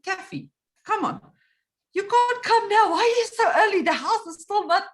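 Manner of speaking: high, loud exasperated pleading; flustered protest. Acted. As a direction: send an early visitor away, rattled. Retold protest to early visitor.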